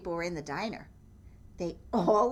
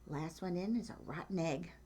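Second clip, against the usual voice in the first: Chicken's thought; 2 semitones lower, 8 dB quieter than usual. Acted. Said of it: "what she thought".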